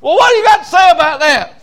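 high loud mock coworker voices; hammed-up irritated workers; annoyed coworkers. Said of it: "And they'd say".

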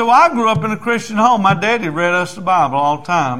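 high, insistent retold wife; defensive protest. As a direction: protest your good upbringing.

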